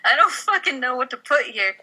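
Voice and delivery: ordinary talk, nothing marked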